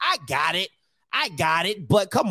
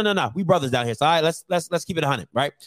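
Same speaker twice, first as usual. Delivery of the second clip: ordinary delivery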